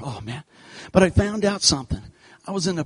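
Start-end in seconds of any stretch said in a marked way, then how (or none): 0.0-0.4 barely-voiced, worn-out oh man; softer, drained sigh of a line. Man finally out of sight, quietly deflated. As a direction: let it fall out deflated. Exhausted relief, very soft.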